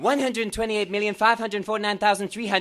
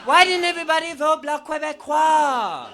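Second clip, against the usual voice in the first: much higher, louder indignant shout; mock outrage; 9 semitones higher, 4 dB louder than usual. Fan yelling at Quebec voters, mock fury. Ranting at referendum result.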